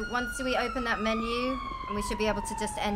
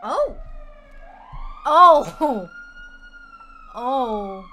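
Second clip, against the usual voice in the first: startled loud oh; real shock then a laugh; surprised shouts; 5 semitones higher, 8 dB louder, much slower than usual. Real, not acted. Sudden surprise in game.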